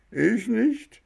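high, pleading insistence rising at the end; insisting. A narrator's reading. Boy insists on his innocence, high and pleading. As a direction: protest your innocence, voice climbing. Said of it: "beteuerte Bengele".

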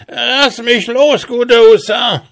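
very high, loud, pitiful whine; wailing dwarf. A narrator's reading. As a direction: wail and plead like a miserable dwarf. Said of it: "heulte und jammerte erbärmlich". (erbärmlich jammernder Zwerg, schrill hoch)

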